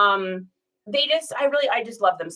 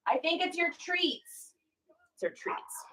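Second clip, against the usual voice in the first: higher, soft and slow pet talk; hushed to the dog; 8 semitones higher, 11 dB quieter than usual. Real, not acted. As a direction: gently let the dog know its treats came. Tells the dog about its parcel.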